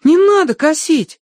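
put-on high-pitched boyish plea; imploring boy; pleading. A narrator's reading. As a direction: beg in a high, drawn-out boy's voice. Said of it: "взмолился Тенька".

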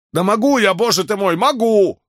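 high, exasperated retort; indignant cry, pitch leaping up; exasperated outburst. A narrator's reading. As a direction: protest sharply at being pressed. Doctor snaps back when pressed.

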